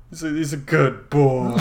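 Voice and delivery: lightly cooing pet talk; puppy dog talk. Acted. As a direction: praise him like a dog. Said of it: "descending into, like, puppy dog talk".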